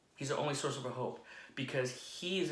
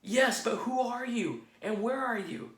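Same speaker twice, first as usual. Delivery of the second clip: calling out; 10 semitones higher, 4 dB louder than usual. Acted. Jack calls to unseen voice.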